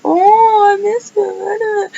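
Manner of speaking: hammed-up tearful friend; mock weepy. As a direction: whimper it like a sad friend. Friends mourning with her.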